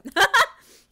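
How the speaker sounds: high, loud burst of laughter. Real, not acted. Streamer giving up on the accents, loud high laugh.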